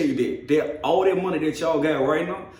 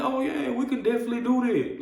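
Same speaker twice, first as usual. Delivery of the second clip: a higher, cheery customer-service voice; cheerful bank rep; 8 semitones higher, faster than usual. Retold or imitated. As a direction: play the eager helpful agent.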